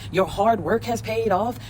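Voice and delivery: ordinary talk, nothing marked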